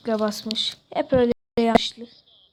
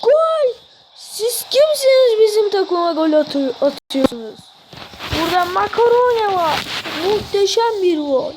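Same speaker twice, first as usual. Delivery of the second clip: screaming football commentator; 6 semitones higher than usual. Real, not acted. Commentating own goal in game.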